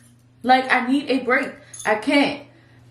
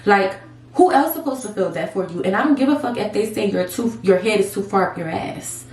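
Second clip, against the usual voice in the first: ordinary talk, nothing marked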